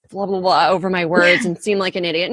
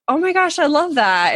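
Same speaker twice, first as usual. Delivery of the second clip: mock gushing, much higher; gushing interviewer habit; 7 semitones higher than usual. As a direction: overeager podcast host gushing at a guest. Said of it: "I'll say".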